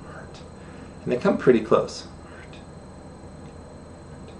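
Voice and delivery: a barely voiced hurt while signing; near-whispered sign name. Shown as a demonstration. Teacher naming the sign softly while demonstrating it. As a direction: say the word almost under breath.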